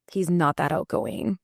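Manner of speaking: ordinary talk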